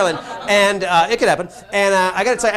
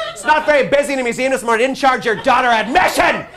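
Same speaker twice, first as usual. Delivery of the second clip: raised, loud, pressing delivery; aggressively friendly urgency; 6 semitones higher, 6 dB louder than usual. Retold or imitated. Museum staff waiving a child's admission, with aggressively friendly urgency. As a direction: push good news as if it were dire. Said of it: "it's with the urgency of".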